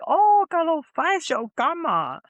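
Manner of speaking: high, loud mock joyful mom; overjoyed mom.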